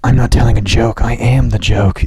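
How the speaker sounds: low, intense mock actor; overserious actor. Mock actor declaring their craft, low and intense. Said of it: "you're acting".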